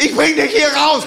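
high, slow heroic cry; hammed-up soldier rescuing a comrade; war-movie hero. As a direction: cry it out like in a Vietnam film. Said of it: "in so 'n Kriegsmodus rein".

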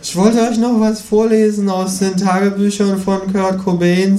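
high lecturing kangaroo voice. A narrator's reading. Kangaroo lectures on evolution in a high voice.

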